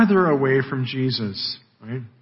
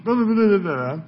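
higher nonsense babble; chattering blabbermouth; 4 semitones higher than usual. Retold or imitated. Peter chatting away by the gate, mock chatter. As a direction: run on like someone who won't shut up. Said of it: "chatting away with somebody".

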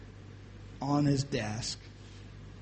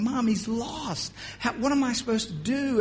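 higher-pitched, distressed appeal to mom; retold upset plea; 5 semitones higher, 3 dB quieter than usual. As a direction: relive being upset, pitch raised. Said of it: "I'm so upset".

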